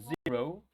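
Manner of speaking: zero with a buzzed Z, rising at the end; vibrating Z. Shown as a demonstration. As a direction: show the voiced Z opening zero. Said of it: "Tiene que existir esa vibración".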